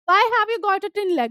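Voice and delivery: reading the story text slowly, word by word; slow reading aloud. Acted. Teacher reading the story aloud to students, slow and clear. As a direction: read each English line slowly and clearly for the class.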